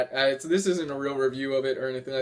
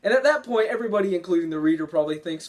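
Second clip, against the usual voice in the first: ordinary delivery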